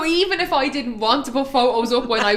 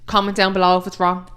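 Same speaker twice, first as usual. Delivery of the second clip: normal talking voice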